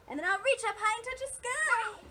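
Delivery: rising, high singsong; high, excited lilt; reaching up high. Shown as a demonstration. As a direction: let the voice stretch up to the sky. Voice climbs with reaching up again.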